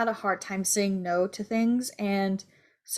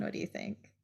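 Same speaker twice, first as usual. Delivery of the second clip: whispered question; 14 dB quieter than usual. Real, not acted. Handing the question over.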